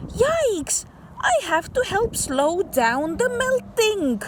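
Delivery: sudden high yelp of alarm. Acted. Child narrator shocked by melting ice, high alarmed yelp. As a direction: squeal it like a startled kid.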